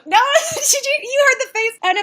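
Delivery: high, loud laughing outburst. Real, not acted. Telling the chat they heard the face palm, laughing. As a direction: burst into real laughter while talking, pitch shooting up.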